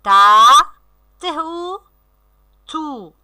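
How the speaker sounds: drawn-out syllables with clear gaps; teacher modelling slow word reading; sounding out. Shown as a demonstration. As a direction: decode the word piece by piece. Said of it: "on lit le mot".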